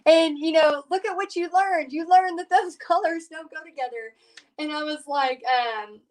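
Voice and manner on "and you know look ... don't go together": higher, cheery imitation of Bobby; lightly voiced upbeat husband; imitating husband Bobby. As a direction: mimic the husband looking on the bright side. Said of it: "And he's like".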